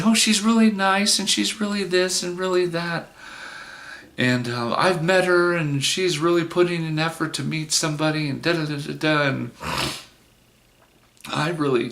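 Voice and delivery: high-pitched mock saleslady voice; imitated saleslady. Saleslady talking him into selecting a date, high imitated sales pitch. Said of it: "She's trying to say".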